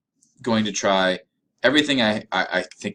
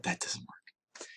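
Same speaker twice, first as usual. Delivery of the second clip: whispered letdown; 15 dB quieter, much slower than usual. Acted. Deflated punchline after repeated failures.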